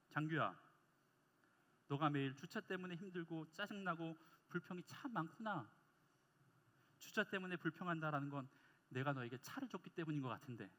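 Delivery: low, soft voice of a patient father; God. Retold or imitated. God answering the grumbling young preacher, low quiet divine voice. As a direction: speak down gently and quietly, with measured pauses. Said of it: "하나님께서 저에게 이렇게 말씀하시는".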